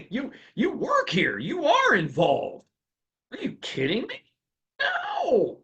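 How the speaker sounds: high, incredulous scolding; mock outrage. Trainer mocking uncooperative employees with high incredulous outrage. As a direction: pitch it way up in disbelief, as if this is absurd.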